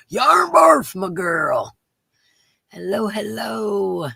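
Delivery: high, loud excited greeting; excited shout. Real, not acted. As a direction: shout the name with joy.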